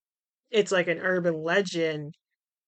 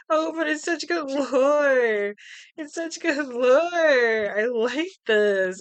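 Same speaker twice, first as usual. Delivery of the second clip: high, slow, gushing delight; gushing fangirl; 7 semitones higher, 3 dB louder, much slower than usual. Retold or imitated. Reading the director's blog, gushing about the lore. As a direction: swoon over the lore like a fan.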